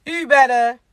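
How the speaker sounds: ordinary talk, nothing marked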